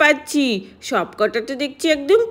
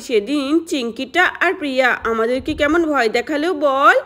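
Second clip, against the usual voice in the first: ordinary delivery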